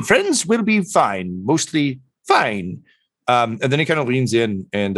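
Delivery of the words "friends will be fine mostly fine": high, drawn-out creature voice; bird doctor. Acted. Bird doctor reassures Tortle about friends, slow high voice. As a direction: reassure slowly, pitched high, with a hedge.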